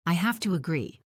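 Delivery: ordinary delivery, nothing marked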